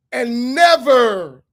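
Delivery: NEVER loud and drawn out; slow, forceful stress on NEVER; emphatic NEVER. Real, not acted. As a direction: hit NEVER hard and stretch it slowly. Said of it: "I emphasize the word never".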